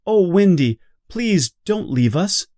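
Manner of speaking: sad plea. Acted. Lost Boys beg Wendy to stay, pleading. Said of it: "The Lost Boys are sad and say".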